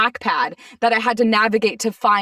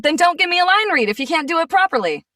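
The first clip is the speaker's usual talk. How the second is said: raised-pitch, indignant retold comeback; sassy telling-off; indignant retort; 8 semitones higher, 3 dB louder than usual. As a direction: snap back at a bad director, higher pitched.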